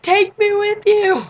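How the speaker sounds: very high, drawn-out yell; loud fangirl shout. Retold or imitated. Loud girl in the crowd calling to departing performers, high fangirl shout. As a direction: scream it slowly like a smitten fan. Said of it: "I'm that loud one".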